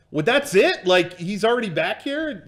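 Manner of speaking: put-out mock disbelief; incredulous. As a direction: imitate an indignant buddy. Voices friend's indignant surprise.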